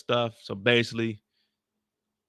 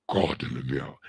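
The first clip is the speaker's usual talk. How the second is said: much lower, menacing; deep growl; 11 semitones lower than usual. Acted. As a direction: drop the voice low and ominous. Deep drug voice.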